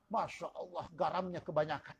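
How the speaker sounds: a hushed grumble to yourself at the table; whispered aside. Acted. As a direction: whisper it under your breath so the wife won't hear. Husband tasting salty food aside. (bisikan pelan suami yang mencicipi masakan keasinan)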